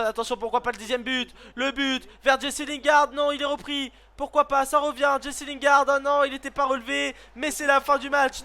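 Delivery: lively commentator calling the final attack; live play-by-play. Acted. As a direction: ride the action like a TV commentator.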